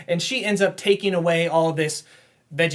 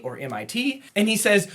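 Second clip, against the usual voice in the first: normal talking voice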